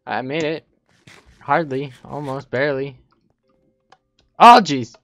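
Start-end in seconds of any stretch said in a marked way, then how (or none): 4.4-4.9 startled. Real, not acted. Startled by a player.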